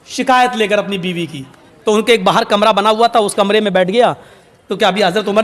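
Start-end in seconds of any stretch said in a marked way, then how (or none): none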